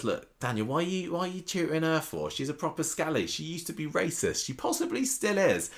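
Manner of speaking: lightly acted outrage, pitch climbing; a hint of a scandalised girlfriend; mock Daisy. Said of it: "Daisy says".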